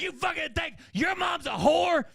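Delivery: high-pitched yelled mock fury; enraged accusation. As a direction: act an enraged mother shouting down her son. Mom confronting son in fight.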